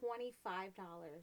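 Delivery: low, hushed and drawn out; low disbelief. Real, not acted. Host balking at the price, low and quiet. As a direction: say it slowly under your breath.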